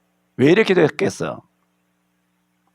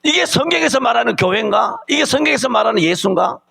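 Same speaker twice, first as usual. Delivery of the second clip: rhetorical shout; 7 semitones higher than usual. Acted. Preacher's rhetorical challenge to congregation.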